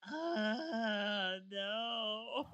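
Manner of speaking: long drawn-out "Uh", then "no"; dismayed groan. Pretend. Woman imagining people copying her, mock dismayed groan. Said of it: "I'm gonna be just like".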